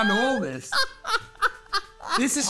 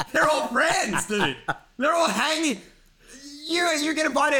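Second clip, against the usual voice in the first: high, laughing exasperation; exasperated rant; 9 semitones higher than usual. Real, not acted. Comic venting about being left out, high and laughing. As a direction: laugh it out in disbelief.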